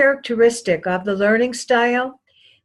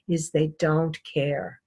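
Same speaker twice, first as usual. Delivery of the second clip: low, soft and slow, almost to herself; quiet aside; 5 semitones lower, 6 dB quieter than usual. Acted. A lecturer quietly telling the class they don't care. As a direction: let it drop away softly. Sums up how they dress.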